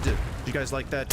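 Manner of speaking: normal talking voice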